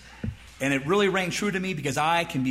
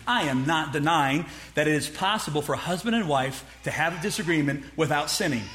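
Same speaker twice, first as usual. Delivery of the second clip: ordinary talk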